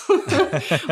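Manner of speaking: quick high laugh that falls away; a brief amused laugh; a real chuckle at her own point; short laugh. Real, not acted.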